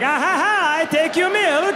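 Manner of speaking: high, gloating Dutch villain; sneaky thief with a put-on Dutch accent; mock Dutch accent. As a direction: taunt in a cartoon Dutch voice. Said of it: "That sounded Dutch".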